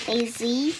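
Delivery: plain reading voice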